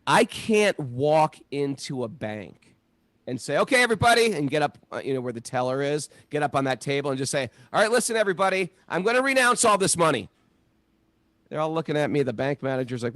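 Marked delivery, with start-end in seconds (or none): 3.6-4.3 high, loud call to the whole room. Pretend. Man on a bank table calls out to everyone, loud. 7.7-10.3 fast, high, loud address to the crowd; rally everyone with a grand declaration; public announcement. Pretend. Man standing on a bank table announces to everyone, loud and fast.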